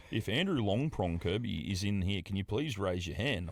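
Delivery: slow, low official announcement; measured request over the airport speakers; airport announcer. Acted. Airport staff seeking a missing passenger, slow announcer voice.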